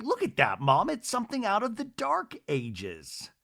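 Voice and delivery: high-pitched scornful son impression; mock disdain. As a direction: imitate a son deriding an old gadget to his mother. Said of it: "And he's like".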